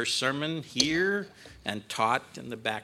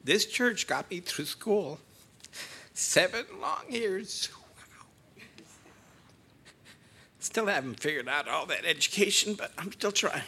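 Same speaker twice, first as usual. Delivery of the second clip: tearful, softer voice; 4 semitones higher, 4 dB quieter than usual. Real, not acted. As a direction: voice thick with tears of gratitude. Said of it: "I don't like being emotional".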